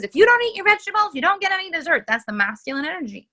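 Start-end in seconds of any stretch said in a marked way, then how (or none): 0.0-2.0 high, loud, fast scolding threat; stern mom. Retold or imitated. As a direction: lay down the law to a child. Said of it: "It's the mom who says".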